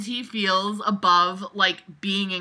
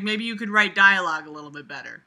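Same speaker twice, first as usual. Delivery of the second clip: ordinary talk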